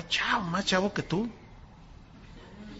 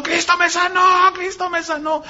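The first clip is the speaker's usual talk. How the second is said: high, held cry of jubilation; imitated joyful shouting; 8 semitones higher than usual. Healed paralytic runs down the hallway, imitated high joyful shouting. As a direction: cry it out high, twice over, without full volume. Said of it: "y gritando y saltando".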